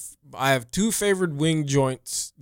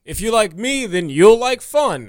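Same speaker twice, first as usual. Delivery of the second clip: Todd voice; 5 semitones higher, 4 dB louder than usual. Acted. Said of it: "I'm Todd".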